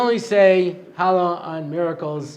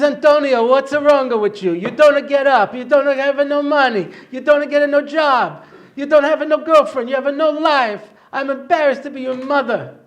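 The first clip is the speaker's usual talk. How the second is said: loud, high scolding in a mock Italian accent; exasperated Italian-accented mother, pitched up and loud; 7 semitones higher, 7 dB louder than usual. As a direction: berate a lazy son like a hammed-up Italian mama. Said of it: "How can you talk like that? We're not Italian".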